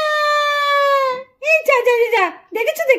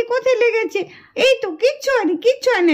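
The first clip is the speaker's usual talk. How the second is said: ordinary talk, nothing marked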